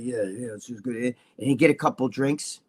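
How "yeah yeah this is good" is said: low, quiet impersonation; soft, low mock small talk; imitating mellow Jimmy. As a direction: murmur it low, playing the easygoing friend. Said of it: "Jimmy could sit there and be really".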